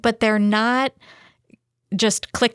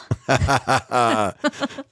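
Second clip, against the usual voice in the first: low, rising laugh after a joke; 8 semitones lower than usual. Real, not acted. Cohost laughs after teasing the host, low laugh. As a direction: laugh at your own fib.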